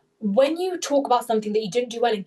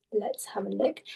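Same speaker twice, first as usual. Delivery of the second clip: whisper; 6 dB quieter than usual. Real, not acted. Aside while checking the questions.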